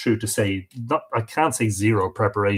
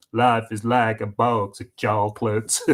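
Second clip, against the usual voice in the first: slow, drawn-out Southern movie voice; slow it down and give Forrest's Southern lilt; Forrest Gump; 2 semitones lower, 3 dB quieter than usual. Retold or imitated. Quoting Forrest Gump to viewers in a slow Southern accent. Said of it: "uh, Forrest Gump".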